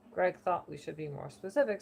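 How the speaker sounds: ordinary talk, nothing marked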